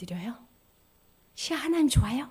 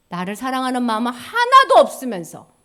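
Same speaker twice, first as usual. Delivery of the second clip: ordinary delivery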